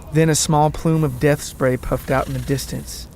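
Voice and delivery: plain reading voice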